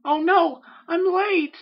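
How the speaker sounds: very high, loud squeal; panicked. A narrator's reading. Filly realising she is late for class, panicked squeal. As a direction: squeak it out in alarm. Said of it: "Sweetie panicked".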